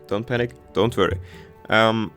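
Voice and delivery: normal talking voice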